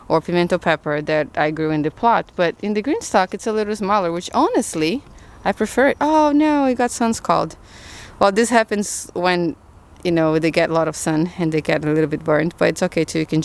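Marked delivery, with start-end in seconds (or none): 6.0-7.6 sudden high "oh no", real dismay; dismayed surprise. Real, not acted. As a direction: catch the damage with a pitch jump. Spots sun scald on pepper.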